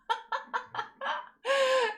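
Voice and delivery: a loud, high burst of laughter falling away; big high cackle at a follower's name. Real, not acted. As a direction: crack up loudly at the joke. Laughs at the Zemmour username.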